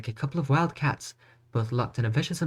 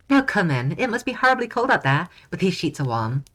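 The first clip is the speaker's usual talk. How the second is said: sweet princess voice; 7 semitones higher, 5 dB louder than usual. Acted. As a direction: play the tender princess, lifted high.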